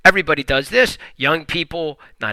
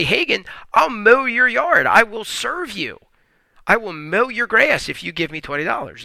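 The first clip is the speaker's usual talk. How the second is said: higher, keen offer to mow the yard, lightly voiced; eager helper offering a deal; 6 semitones higher than usual. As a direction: pitch it up like a kid selling yard work. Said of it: "Or you could come and say".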